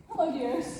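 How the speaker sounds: ordinary delivery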